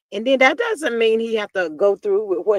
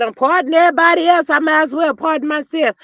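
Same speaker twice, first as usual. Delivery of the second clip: mocking quote; 6 semitones higher, 8 dB louder than usual. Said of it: "He said".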